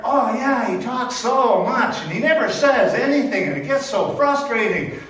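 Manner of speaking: loud, high exasperated sibling voices; mocking siblings. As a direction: complain about the kid as if he weren't there. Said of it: "saying, as if I'm not even there".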